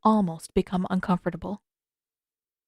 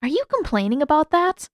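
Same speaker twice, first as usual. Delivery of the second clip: higher playful challenge; higher teasing comeback; teasing Katara; 7 semitones higher, 3 dB louder than usual. Acted. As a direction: tease him back.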